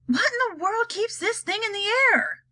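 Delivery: put-on high-pitched old woman's croak; high, lightly croaked question; croaking grandma. A narrator's reading. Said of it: "croaked Grandma Josephine".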